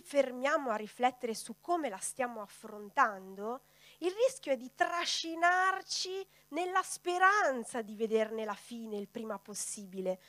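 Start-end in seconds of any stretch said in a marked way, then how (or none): none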